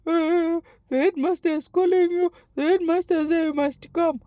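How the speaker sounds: put-on high, wailing child's voice; imitated crying. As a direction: deliver the message through mock sobs. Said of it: "Now I'm crying".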